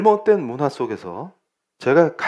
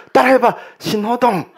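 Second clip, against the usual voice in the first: high, loud mock scolding; hammed-up telling-off, pitched way up; angry mother; 9 semitones higher, 3 dB louder than usual. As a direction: imitate a mom drilling her child crossly. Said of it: "엄마가 화를 내면서".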